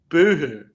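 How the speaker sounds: loud sarcastic boo-hoo; hammed-up crybaby taunt; mock crying. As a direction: fake sob to mock the whiners. Mocking players who quit.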